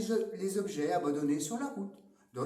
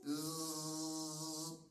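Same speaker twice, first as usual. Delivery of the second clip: soft buzzing fly noise; quiet fly buzz; 10 dB quieter than usual. Acted. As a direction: buzz like a fly near an ear. Fly buzzing around the wolf.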